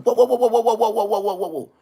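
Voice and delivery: alarmed whoa. Retold or imitated. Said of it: "I was like".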